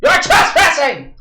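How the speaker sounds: high-pitched indignant holler at the walls; high, drawn-out demanding yell. Pretend. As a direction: yell up at whoever is inside. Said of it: "I just yell again".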